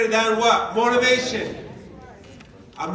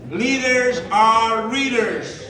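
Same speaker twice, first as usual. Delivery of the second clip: slow and louder, each word weighed; slow emphatic line; 5 dB louder, much slower than usual. Real, not acted. Speaker to audience closing the point, slow and emphatic. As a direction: land the slogan slowly and firmly.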